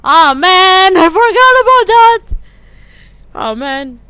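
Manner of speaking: panicked outburst. Acted. Panic over forgotten mess.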